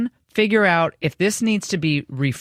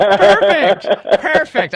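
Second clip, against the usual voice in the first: mock satisfied; 10 semitones higher, 7 dB louder, much slower than usual. Joking about royalties deal.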